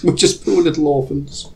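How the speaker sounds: high, hammed-up pitiful orphan voice; mock pitiful whimper; poor little orphan. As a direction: play the pathetic orphan for sympathy. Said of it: "begging, orphan pose".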